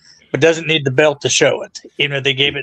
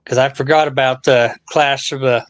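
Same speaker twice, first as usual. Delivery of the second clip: usual speaking voice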